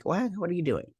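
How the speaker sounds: high-pitched mock bafflement; incredulous reaction. Viewer reacting to the gambler tossing his gun, in high-pitched disbelief. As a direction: shoot the question up high in disbelief.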